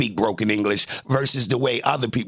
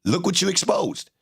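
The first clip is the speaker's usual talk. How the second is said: higher, gloating repeat; taunting; 6 semitones higher than usual. Real, not acted. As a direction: rub it in his face. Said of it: "repeats higher".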